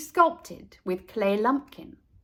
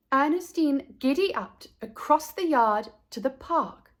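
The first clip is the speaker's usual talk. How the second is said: plain reading voice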